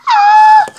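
very loud, high pretend scream; startled scream. Toy character spots something creepy, startled scream. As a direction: shriek at a sudden fright.

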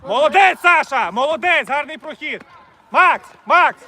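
loud praise from the sideline; shouted praise. Real, not acted. Coach praising a player's run, shouting. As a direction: cheer him loudly then call out.

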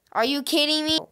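fed-up loud cry; exasperated outburst. Real, not acted. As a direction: raise the voice in frustrated disbelief. Same question escalates in frustration.

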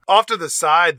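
ordinary talk, nothing marked

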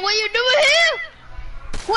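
drawn out, climbing high; slow, rising call to another player. Real, not acted. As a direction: stretch the question upward into a holler.

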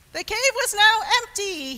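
high, loud burst of surprise; astonished narration. A narrator's reading. Storyteller prompting surprised faces from children, astonished. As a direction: repeat the reveal in big astonishment. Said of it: "Let me see some surprised faces".

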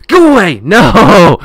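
very loud, high-pitched shout; alarmed high holler; panicked yell. Real, not acted. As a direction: yell to drive it off. Chased in game.

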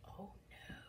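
very soft whispered worry; barely audible, breath-only reaction; hushed dismay. Acted. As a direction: whisper it as an aside. Reader reacts to the splash.

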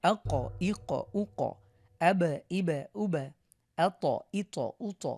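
final q, b, t bounced loudly; punchy qalqalah bounce on the last letter; qalqalah push. Shown as a demonstration. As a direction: push off each closing consonant with an echo. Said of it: "ধাক্কা দিয়ে পড়েছি".

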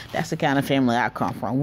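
normal talking voice

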